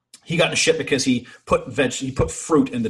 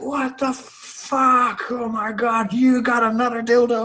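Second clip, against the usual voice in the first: very high, stretched-out mock outrage; steaming mad; 12 semitones higher, 3 dB louder, slower than usual. Sailor finding the prank back again, high exaggerated outrage. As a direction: imitate him blowing his top, first words drawn long. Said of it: "a man's ears actually, like, blow steam".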